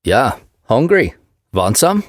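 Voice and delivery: put-on big Swede, heavy Swedish accent. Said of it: "his Swedish accent thick".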